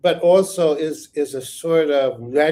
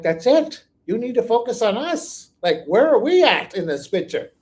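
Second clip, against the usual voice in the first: mock Russian citizens; 6 semitones higher, 3 dB louder than usual. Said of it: "the people of Russia are like".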